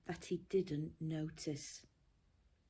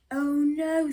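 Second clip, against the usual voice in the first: loud, high groan of frustration; exasperated Mister Bear; 9 semitones higher, 12 dB louder than usual. Acted. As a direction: cry it out like a sleepless bear at the end of his rope.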